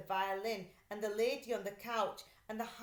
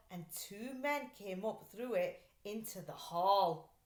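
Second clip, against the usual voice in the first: plain reading voice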